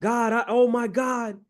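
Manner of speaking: high-pitched, overjoyed son; acted-out elated kid; crazy excitement. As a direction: burst out to Dad, thrilled and high. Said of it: "he's just going crazy of excitement".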